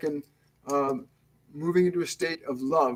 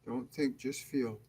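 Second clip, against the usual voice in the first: guided meditation; 4 dB quieter than usual. Acted. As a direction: ease them into calm, unhurried and hushed. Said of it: "we're going to start the meditation now".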